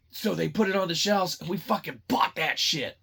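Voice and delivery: ordinary talk, nothing marked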